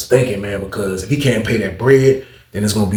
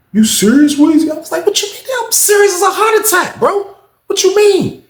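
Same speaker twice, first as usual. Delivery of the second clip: much higher, louder, exasperated; exasperated outburst; 4 dB louder than usual. Retold or imitated. Narrator shouting he is serious as a heart attack, much higher and louder. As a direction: throw up your hands shouting. Heated exchange, pitch soars.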